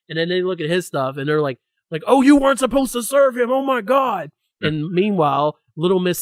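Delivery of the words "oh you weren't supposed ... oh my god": high, loud mocking panic; mock panicked bartender. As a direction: ham up the staff freaking out.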